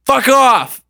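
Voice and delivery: high, drawn-out mock yell; retold yell. Telling the dream's first words to a distant figure, retold yell. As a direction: yell it as if calling far off. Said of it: "The first thing I yell".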